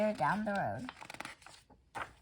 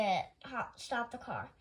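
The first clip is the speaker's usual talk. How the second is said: ordinary delivery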